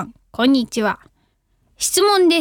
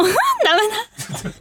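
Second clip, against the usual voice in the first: laughing; 7 semitones higher, much slower than usual. Real, not acted. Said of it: "cracking up mid-read".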